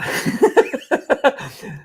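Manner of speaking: breathless high giggle; real laugh, short of breath. Real, not acted. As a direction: let out a squeaky airy laugh.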